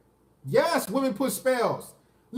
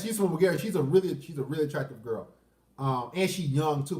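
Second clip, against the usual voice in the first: usual speaking voice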